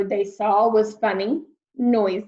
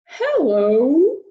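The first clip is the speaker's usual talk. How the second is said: big, louder whale greeting; friendly whale voice; 4 semitones higher, 6 dB louder than usual. Acted. Whale greeting lost Peter, big and friendly. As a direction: boom out a kindly hello.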